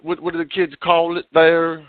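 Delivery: usual speaking voice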